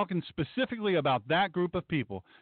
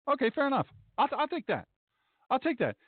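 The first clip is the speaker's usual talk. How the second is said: higher-pitched mock protester reply; 7 semitones higher than usual. Host playing protesters answering about police, mock role voice. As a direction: lightly put on a protester's conceding voice.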